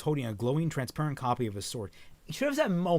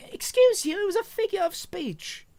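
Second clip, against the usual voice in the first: squeaky, sky-high Australian attempt; badly faked Australian, pitched right up; attempted Australian accent; 4 dB louder than usual. Velvet correcting her partner after blushing, squeaky attempted Australian accent. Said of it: "work on my Australian accent".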